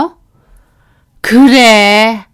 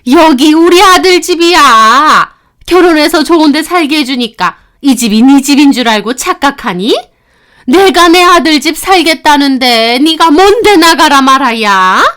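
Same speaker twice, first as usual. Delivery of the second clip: louder and higher, lecturing with contempt; angry mother-in-law; 4 semitones higher, 5 dB louder than usual. Acted. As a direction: scold her for forgetting her place. Mother-in-law scolding daughter-in-law. (며느리를 꾸짖는 화난 시어머니)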